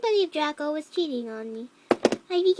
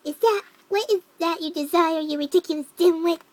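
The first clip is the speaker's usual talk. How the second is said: louder, forceful angry Snape; angry demand; 2 semitones higher, 8 dB louder than usual. A narrator's reading. Snape snapping at a student he calls a dimwit, angry demand. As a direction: demand it angrily, hitting the words hard. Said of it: "Snape demanded angrily, his cold voice".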